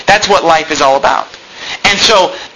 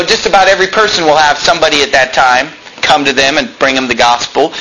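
ordinary talk

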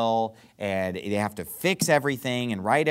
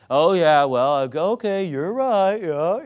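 mock agreement, loud drawn-out start; patronizing; 3 semitones higher, 5 dB louder, slower than usual. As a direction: humor them with exaggerated, condescending agreement. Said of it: "patronizing way, like".

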